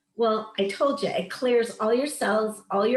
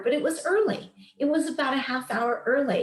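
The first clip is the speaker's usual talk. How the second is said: normal talking voice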